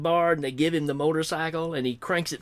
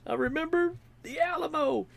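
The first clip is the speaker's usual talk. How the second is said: high, hammed-up movie dialogue; acted movie lines; 9 semitones higher, faster than usual. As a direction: play both parts of the scene, higher.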